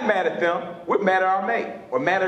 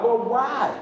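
retold plea; 4 semitones higher than usual. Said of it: "I told God".

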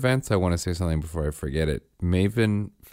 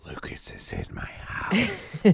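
slow, breathy mock whisper; 11 semitones higher, 9 dB quieter, slower than usual. Dad imitating his daughter's whispered secret in a slow whisper. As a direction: hush it like a giddy secret. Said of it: "looked over at me and whispered".

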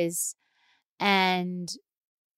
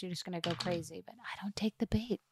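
whisper; 3 semitones lower, 15 dB quieter than usual. Real, not acted. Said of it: "drops into a whisper".

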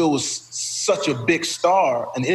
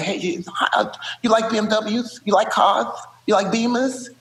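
put-on high squeaky lisping pitch to a girl; 6 semitones higher than usual. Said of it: "He'd be like".

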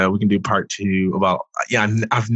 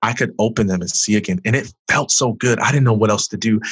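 ordinary talk, nothing marked

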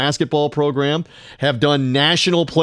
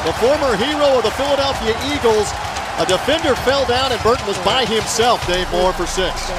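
excited radio play-by-play call; rising to a touchdown call; live play-by-play; 6 semitones higher than usual. Acted. Plays own radio call.

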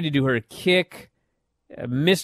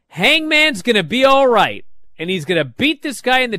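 excited insistence; 7 semitones higher, 4 dB louder than usual. Real, not acted. Emphatic reassurance to doubting fans.

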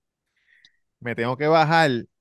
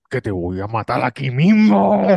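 slow, heavy death threat; a hint of a crazed stranger; menacing threat; 2 semitones lower, 6 dB louder than usual. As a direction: threaten him like a madman.

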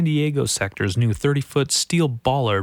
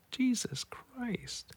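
shaken whisper; 11 semitones higher, 12 dB quieter, much slower than usual. Real, not acted. After sad news.

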